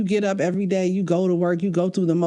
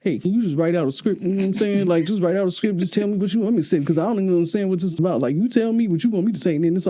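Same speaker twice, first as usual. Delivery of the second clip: fast, lightly voiced husband, casual; imitating Alan; faster than usual. Wife imitates her husband asking her for a script, fast and casual. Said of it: "do my voice".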